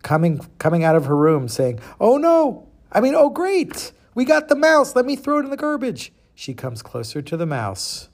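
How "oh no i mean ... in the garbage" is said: very high put-on voice; high-pitched farm wife, louder at first; farmer's missus. Missus thinks the mouse is caught, high put-on voice. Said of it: "the missus".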